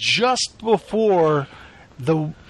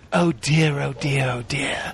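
Gordon Ramsay; 5 semitones lower, slower than usual. Retold or imitated. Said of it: "I literally heard him say".

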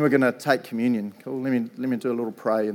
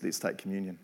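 low, softened voice of Jesus; lightly voiced Jesus, lower and softer; 5 semitones lower, 8 dB quieter than usual. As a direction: speak gently as an imagined Jesus. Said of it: "Jesus goes".

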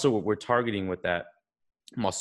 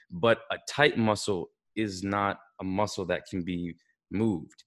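ordinary talk, nothing marked

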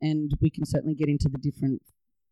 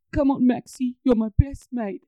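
mild retold crying, higher voice; imitating a tearful man; 9 semitones higher than usual. Owner farewelling his dead dog, a hint of tears. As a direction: echo the owner's choked goodbye, lightly. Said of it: "he's just bawling his eyes out".

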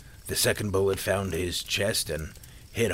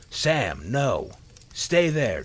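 loud warning, rising higher as it goes; desperate yell; 5 semitones higher, 7 dB louder, much slower than usual. A narrator's reading. Father warning his running son away, desperate yell. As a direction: yell desperately to stop a child. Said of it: "yelled in a desperate and cracking voice".

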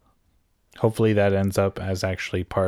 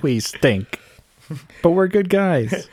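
hammed-up, higher-pitched pleading; mock stink bug plea; 8 semitones higher, 4 dB louder, slower than usual. Stink bug pleading with the bullies, mock plea. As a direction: play at a slow, pitiful protest.

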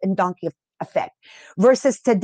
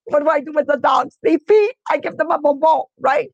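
raised, incredulous dog-trainer voice; mock exasperated shout; 5 semitones higher than usual. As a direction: ham up the disbelief.

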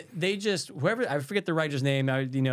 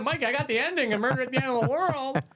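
high-pitched mock excitement at finally knowing; excited announcement; 10 semitones higher than usual. Telling a friend the long-awaited ending, mock excited. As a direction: announce it high, playfully overexcited.

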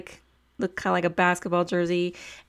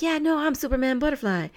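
a touch higher, breezily sure of himself; confident guide; 6 semitones higher than usual. Retold or imitated. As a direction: toss it off like it's obvious. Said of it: "just his confidence of".

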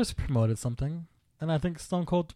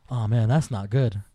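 calm understated reaction; 7 semitones lower than usual. Retold or imitated. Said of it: "He just".

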